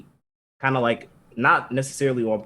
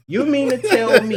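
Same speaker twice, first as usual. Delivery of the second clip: high-pitched, laughing disbelief; incredulous outrage; 10 semitones higher, 4 dB louder than usual. Real, not acted. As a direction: confront the character in high, incredulous protest. Indignant rant at the character.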